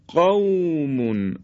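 "قومون" with aw drawn out in a smooth glide; soft līn. Shown as a demonstration. Teacher models waw after fatha in "قومون", soft līn. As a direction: ease slowly through the aw of "قومون".

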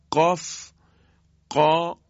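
"قا" released sharply after a held stop; forceful qaf. Shown as a demonstration. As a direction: hit the q in "قاف" hard from the very back of the tongue.

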